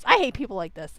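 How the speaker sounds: normal talking voice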